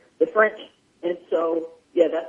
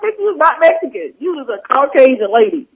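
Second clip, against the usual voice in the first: raised-pitch mock indignation; mad, retold; 6 semitones higher, 4 dB louder than usual. Student retelling objection to teacher acting Mexican, indignant. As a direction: correct them with exaggerated, high-pitched exasperation. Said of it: "This used to make me mad".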